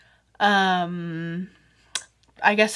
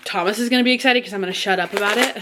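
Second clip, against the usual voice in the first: ordinary talk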